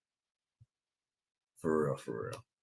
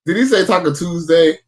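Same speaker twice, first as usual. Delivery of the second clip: stunned; 9 semitones higher, 9 dB louder than usual. Real, not acted. Disbelief at clip's Taco Tuesday.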